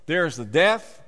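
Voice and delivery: ordinary talk, nothing marked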